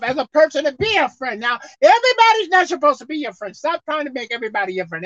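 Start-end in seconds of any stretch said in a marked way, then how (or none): none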